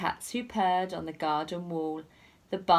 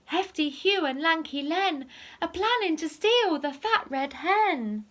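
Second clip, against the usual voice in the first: ladybird warning; 9 semitones higher than usual. Acted. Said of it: "told the animals".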